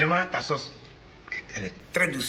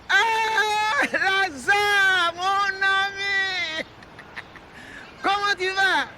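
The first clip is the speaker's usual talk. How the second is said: overjoyed greeting; 10 semitones higher, much slower than usual. Acted. Old man greeting his friend Lazare on the phone, high and drawn out. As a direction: stretch the friend's name out in delight.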